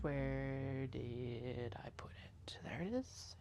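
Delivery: whispered to herself under the breath; whispering. Real, not acted. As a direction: whisper it like thinking aloud. Searching, talking to herself.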